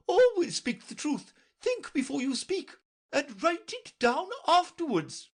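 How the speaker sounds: very high, imperious royal voice; Red Queen. A narrator's reading. Red Queen lecturing Alice, high and imperious. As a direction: talk down to the child.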